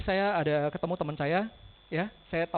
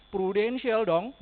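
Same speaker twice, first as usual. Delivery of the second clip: slow, drawn-out and louder, proudly; proud boast; 3 semitones higher, 5 dB louder, much slower than usual. Retold or imitated. Client proudly telling hospital staff her insurer, slow. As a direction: savor the brand name Prudential.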